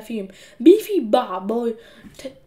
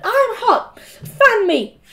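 put-on high, louder bossy girl; imperious order; 10 semitones higher, 6 dB louder, much slower than usual. A narrator's reading. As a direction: demand service like a little boss. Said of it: "she ordered".

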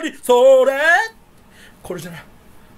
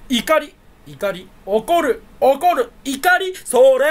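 hammed-up gag voice; 4 semitones higher, 4 dB louder, much slower than usual. Acting a gag performance.

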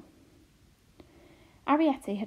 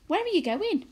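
louder, higher sharp question; calling out; 10 semitones higher, 5 dB louder than usual. A narrator's reading. A mother calls after her daughter leaving the room. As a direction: catch her before she slips out.

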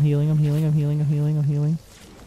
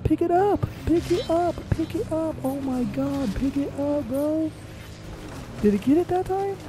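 high, frantic squeals at the screen; panicked gamer yelling; frantic pleading; 14 semitones higher than usual. Real, not acted. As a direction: beg the game to grab the card.

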